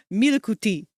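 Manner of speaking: milku tea with a light Japanese accent; bad Japanese accent. Shown as a demonstration. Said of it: "try to do a bad Japanese accent".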